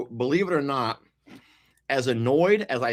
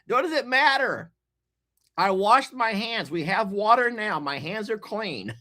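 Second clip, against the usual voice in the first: defensive protest; 10 semitones higher than usual. Real, not acted. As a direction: insist you did nothing wrong, then trail off. Continuing defensive rant.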